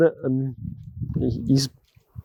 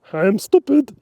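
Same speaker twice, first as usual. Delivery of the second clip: dog's voice; 8 semitones higher than usual. Pretend. Still voicing the dog.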